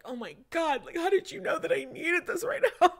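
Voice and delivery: high, loud, laughing gush; touched girlfriend reads her husband's text, laughing and high; overjoyed. Pretend. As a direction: squeal it out through happy laughter. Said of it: "You're like".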